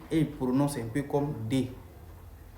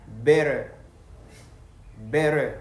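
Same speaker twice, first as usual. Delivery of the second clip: better with the T flapped like a D, American style; American accent; 7 dB louder, much slower than usual. Shown as a demonstration. Explaining to a French learner, American better. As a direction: say better the American way, louder, ber-der. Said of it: "lui va te dire".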